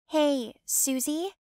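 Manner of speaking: slow, sing-song taunting call; mocking call. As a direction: drawl out the name teasingly. Cousin calls her back mockingly.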